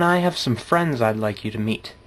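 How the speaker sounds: ordinary talk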